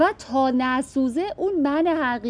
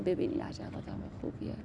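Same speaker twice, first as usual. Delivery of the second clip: hushed, breathy aside of fake admiration; whispered mock praise; 5 semitones lower, 14 dB quieter, faster than usual. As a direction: whisper it like gossip about a saint. Others praising the pious show-off. (زمزمهٔ تمسخرآمیز)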